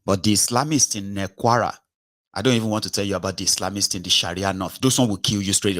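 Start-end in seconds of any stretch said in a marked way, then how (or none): none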